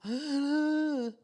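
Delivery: sharp surprised gasp; loud startled intake of breath. Acted. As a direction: gasp as if caught off guard. Startled by prospect of success.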